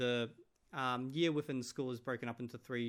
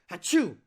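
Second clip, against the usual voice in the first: loud, hammed-up mock sneeze; fake sneeze; 5 semitones higher, 13 dB louder than usual. Mimics the sneeze in video.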